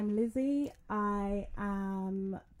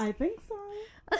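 mock whimper; 12 semitones higher, slower than usual. Shop closed joke, whiny.

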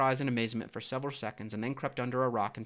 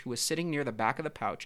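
ordinary delivery

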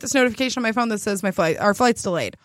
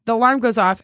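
ordinary talk

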